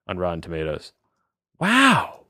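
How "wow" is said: high, amazed wow; stunned. Real, not acted. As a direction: let the surprise pop out. Shocked by review score.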